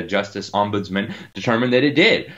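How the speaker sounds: usual speaking voice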